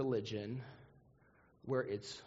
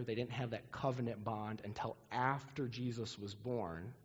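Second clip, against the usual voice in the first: ordinary delivery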